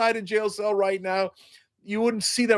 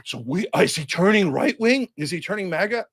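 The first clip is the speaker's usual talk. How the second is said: mock worried fan; 3 semitones higher than usual. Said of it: "and they're like".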